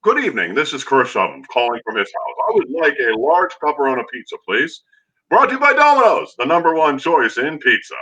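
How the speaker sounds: mock play-by-play voice with a sponsor plug; imitating Chris. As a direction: ham up a broadcaster calling in a pizza order. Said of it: "does it sound like".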